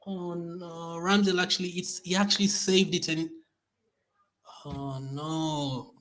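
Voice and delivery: high, deflated whimpering; dismayed whimper. Real, not acted. Fan lamenting the keeper's near save, dismayed whimper. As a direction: lament it high, the force gone.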